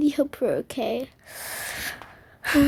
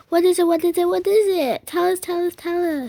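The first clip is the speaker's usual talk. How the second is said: put-on eager kids clamoring; 6 semitones higher than usual. Kids clamoring at mom for the news, eager. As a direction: pester for the answer.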